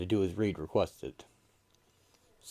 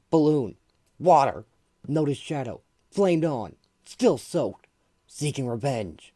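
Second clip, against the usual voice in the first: put-on loud clipped young hero; 7 semitones higher, 7 dB louder than usual. Johnny's clipped loud report.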